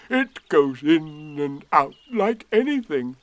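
loud, slow relish; delighted. Acted. Eeyore showing off his pot, delighted.

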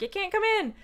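high, prim witch voice; witch refusing. Retold or imitated. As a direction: refuse her in a lifted mock-witch tone. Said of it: "And the witch is like".

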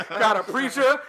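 ordinary talk, nothing marked

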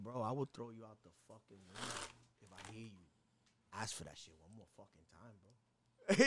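low, hushed, menacing threat; quiet threat. Retold or imitated. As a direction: threaten him low and soft. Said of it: "I said".

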